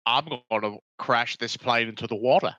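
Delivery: low voice declaring a reckless plan; Michael Caine. Acted. Actor telling crew he'll crash the plane, low impression. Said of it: "Michael Caine just said".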